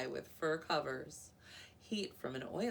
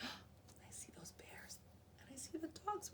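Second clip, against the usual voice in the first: whispered aside; 11 semitones higher, 9 dB quieter than usual. Real, not acted. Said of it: "reader whispers to children".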